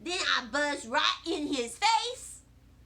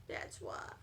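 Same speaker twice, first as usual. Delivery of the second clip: whispered sulky aside; 12 dB quieter than usual. A narrator's reading. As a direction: breathe it out under your breath. Sulking end of chapter.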